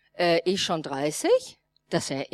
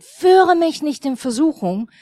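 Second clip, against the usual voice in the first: louder, higher, earnest cry of "Führe mich nicht in Versuchung"; loud prayer; 6 semitones higher, 7 dB louder than usual. Retold or imitated. Telling the congregation how she prayed in temptation, raised earnest plea. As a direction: call out "Führe" with force, like pleading with God.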